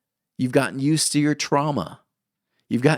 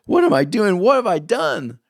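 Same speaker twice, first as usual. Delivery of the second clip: mock panic; 10 semitones higher, 6 dB louder than usual.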